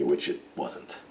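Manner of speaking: breathy throwaway confession, under the breath; whispered aside. Real, not acted. Reviewer admits the premise bored her, whispered aside. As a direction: drop to a dry whisper for the verdict.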